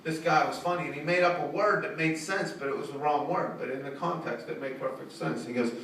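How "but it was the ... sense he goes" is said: ordinary delivery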